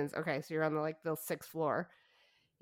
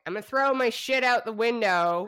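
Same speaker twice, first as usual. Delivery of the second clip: calling out; 5 semitones higher, 9 dB louder than usual. Retold or imitated. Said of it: "basically you're saying".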